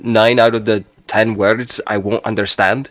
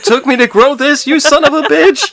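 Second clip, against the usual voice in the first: high, loud comic indignation; over-the-top outraged squeal; mock outrage; 5 dB louder than usual. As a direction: yell it in hammed-up outrage at the culprit. Acting out outrage at haircut attacker.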